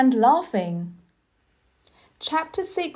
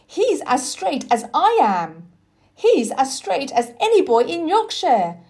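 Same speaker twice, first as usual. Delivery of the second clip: louder, higher joyful exclamation; excited cry; 6 semitones higher, 5 dB louder than usual. Acted. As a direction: cheer him on with delight. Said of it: "cried Dickon".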